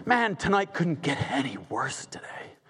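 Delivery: a hushed, defeated whisper under his breath; despairing whisper. Acted. Haman whispering his despair to himself at home. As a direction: breathe it out like a beaten man.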